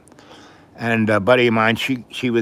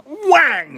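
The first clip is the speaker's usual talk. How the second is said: very high sharp "whang"; slap sound; 6 dB louder than usual. Retold or imitated. Grandma slapping the kid again, sharp high slap sound. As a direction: hit the slap noise hard and high.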